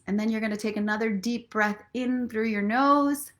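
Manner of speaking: ordinary talk, nothing marked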